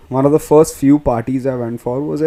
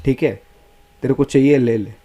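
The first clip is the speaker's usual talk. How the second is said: usual speaking voice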